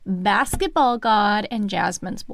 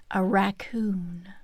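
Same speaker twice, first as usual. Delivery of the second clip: hushed reveal; 3 semitones lower, 4 dB quieter, slower than usual. Pretend. Deadpan reveal.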